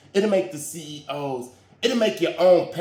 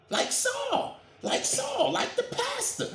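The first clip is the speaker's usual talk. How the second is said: mocking falsetto. Mocking high-pitched voice.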